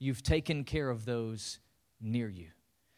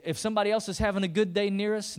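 ordinary delivery

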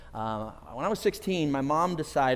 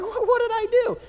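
shocked; 6 dB louder than usual. Retold or imitated. Shocked teen asks friend.

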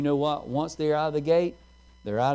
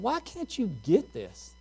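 higher, slower, frustrated father; 7 semitones higher, slower than usual. Retold or imitated. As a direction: let impatience with the child ring out. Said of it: "Just the way I said that with that tone".

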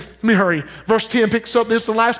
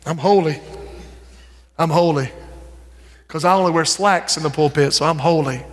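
low, smug "I'm holy"; mock self-righteous; 8 semitones lower than usual. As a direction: lower voice, preening piety. Mocking self-righteous churchgoer.

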